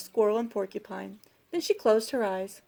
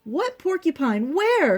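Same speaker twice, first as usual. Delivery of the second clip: higher cry, rising in volume; puzzled cry; 7 semitones higher, 4 dB louder, slower than usual. A narrator's reading. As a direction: cry out in storybook disbelief. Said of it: "cried Squirrel, Bear, and Little Mouse".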